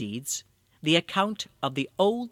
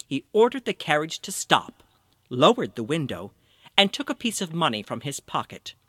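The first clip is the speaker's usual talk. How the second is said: usual speaking voice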